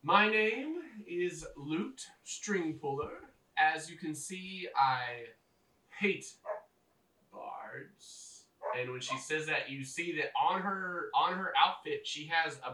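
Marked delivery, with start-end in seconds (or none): 0.0-8.4 slow, deliberate goblin woman's voice; goblin shaman. Acted. Goblin leader introducing herself to captives, slow deliberate voice. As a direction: draw out the introduction with weighty pauses. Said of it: "she looks at you and she goes".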